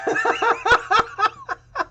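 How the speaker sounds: a high burst of real laughter; high delighted laugh. Real, not acted. GM bursts out laughing at the player's luck. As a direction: crack up in pure delight. Reaction to the lucky roll.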